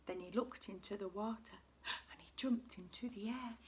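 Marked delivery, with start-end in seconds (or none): none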